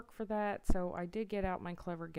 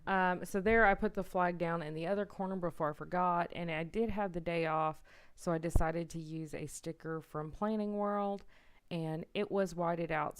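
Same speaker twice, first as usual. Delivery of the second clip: louder, projected voiceover; speaking up; 6 dB louder than usual. Real, not acted. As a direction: speak up so viewers can hear you. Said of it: "let me speak up a little bit".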